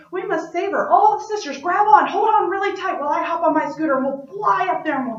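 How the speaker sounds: loud, high, fast rallying call; urgent and bold, rushing the plan out; first sister calling the sisters to the rescue; rallying cry. Acted.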